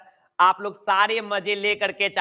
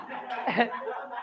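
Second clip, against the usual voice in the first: breathy chuckle; 10 dB quieter than usual. Real, not acted. Teacher laughs at own joke.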